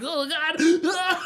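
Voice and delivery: high-pitched retold cry of horror; comically horrified, high squeal; mock horror. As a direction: wail it in hammed-up dismay. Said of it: "the horror was gone".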